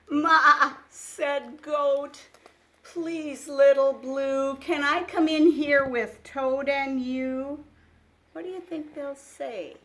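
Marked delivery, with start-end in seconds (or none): 0.1-7.6 put-on louder, lively read-aloud for small children; picture book reading. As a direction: read it like a bedtime storybook. Reads animal dialogue.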